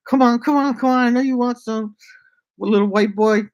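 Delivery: high, loud mock come-on; hooker's come-on. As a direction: sing out a high teasing come-on. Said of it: "She's like".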